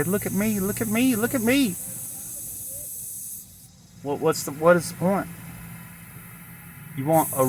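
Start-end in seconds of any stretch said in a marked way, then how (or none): 0.0-1.7 high mock plea, climbing with each repeat; attention seeker. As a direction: beg to be noticed, pitched ever higher. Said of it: "You want somebody to say".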